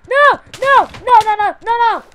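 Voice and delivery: frantic high-pitched yelling; shouting no. Real, not acted. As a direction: scream no over and over. Armadura perdida, desespero no jogo. (gritando desesperado)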